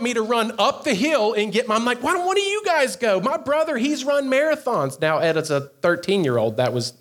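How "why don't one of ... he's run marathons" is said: put-on much higher, pleading kid's whine; whiny plea. Boy begs his brother and friend to go instead, high whiny plea. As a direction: beg like a scared ten-year-old. Kid begging others to fetch dad.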